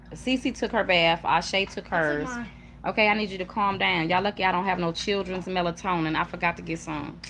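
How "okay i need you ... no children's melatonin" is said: ordinary talk, nothing marked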